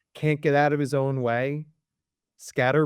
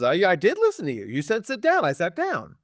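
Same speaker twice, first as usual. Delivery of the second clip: put-on higher-pitched child defending himself; protesting kid; 6 semitones higher than usual. Kid answers the scolding teacher about sitting down, protesting. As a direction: protest back in a raised kid voice. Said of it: "And the kid says".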